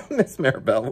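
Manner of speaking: ordinary delivery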